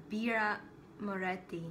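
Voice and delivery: "Birra Moretti" said as an Italian beer name; pronunciation demo. Shown as a demonstration. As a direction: show how to pronounce "Birra Moretti". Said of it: "I think you pronounce it".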